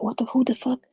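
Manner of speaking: high, soft bewilderment; stunned disbelief. Retold or imitated. Nine-year-old watching birth show, hushed high shock. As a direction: ask it small and high, stunned.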